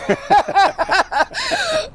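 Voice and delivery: high, loud burst of laughter. Real, not acted. Speaker after her mock interruption, loud high laughing. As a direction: crack up at your own outburst.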